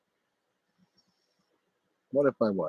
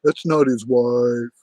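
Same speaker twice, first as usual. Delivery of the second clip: louder, sneering mimic of the accusers; mocking the doubters; 2 semitones higher, 6 dB louder than usual. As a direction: parrot their claims with scorn. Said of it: "They say".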